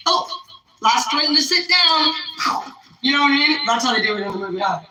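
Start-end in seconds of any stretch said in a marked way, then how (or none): 0.1-2.1 slow, higher sing-song call; playful game call. Pretend. Vlogger playing a game host teasing the last one standing, sing-song. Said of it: "You're like".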